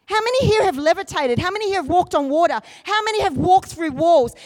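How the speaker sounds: high, rousing preacher's call; fervent preaching. Real, not acted. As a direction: preach it with urgent, insistent force. Preaches at full force.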